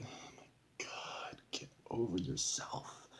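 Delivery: breathless whisper, rolling the eyes; exasperated whisper. Retold or imitated. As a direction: hiss it under your breath in disbelief. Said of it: "I'm like".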